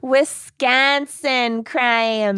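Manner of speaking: very slow, drawn-out reveal; slow dramatic announcement. Acted. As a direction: draw out "Wisconsin" slowly.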